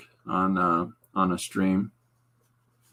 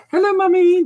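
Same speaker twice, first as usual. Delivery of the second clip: put-on very high, louder little-kid voice; sweet child voice; 8 dB louder than usual. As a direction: squeak it like a perfect child. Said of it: "just a wonderful blessing of a child".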